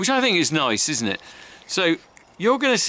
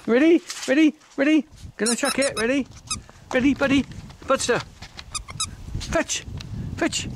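much higher, excited sing-song to the dog; dog-talk voice; 11 semitones higher than usual. Real, not acted. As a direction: coax the dog to get ready for the throw. Playing fetch with the dog.

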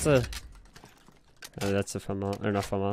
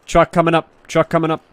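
loud, higher callout of danger; warning shout; 6 semitones higher, 8 dB louder than usual. Real, not acted. Warning teammates of incoming truck.

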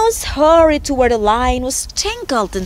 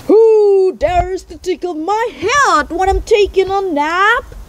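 higher, lightly voiced indignant lion; mild lion roar; 6 semitones higher, slower than usual. A narrator's reading. Woken lion to whoever tickled its head, mild roar. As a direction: demand it like a bothered lion, restrained roar. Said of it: "roared the lion".

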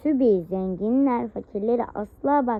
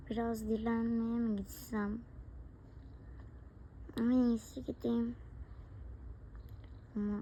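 quiet musing; 2 semitones lower, 14 dB quieter than usual. Acted. Tired parent musing alone.

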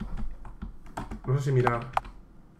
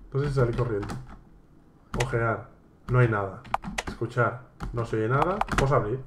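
usual speaking voice

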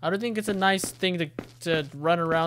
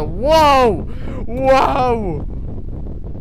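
very loud, high whoa; startled shout; 7 semitones higher, 10 dB louder than usual. Real, not acted. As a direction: yell in shock, drawing it out. Startled by the game's jump scare.

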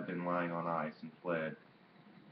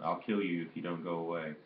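ordinary delivery, nothing marked

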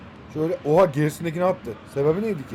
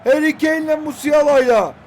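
match commentator; 6 semitones higher, 4 dB louder than usual. Acted. Gamer hyping his match like a TV match commentator. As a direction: hype the stadium like a live broadcast.

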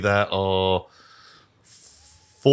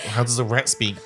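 ordinary talk, nothing marked

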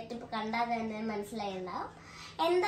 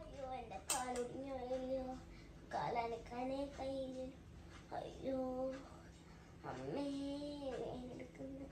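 groaning in pain; 10 dB quieter than usual. Acted. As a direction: groan weakly from the bed. Father with broken leg moaning.

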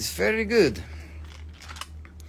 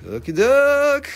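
much higher, louder, slow silly voice; host clowning between items; squeaky okie doke; 10 semitones higher, 5 dB louder, slower than usual. Acted. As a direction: pop up into a cartoon voice.

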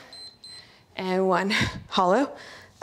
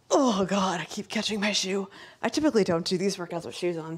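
ordinary talk

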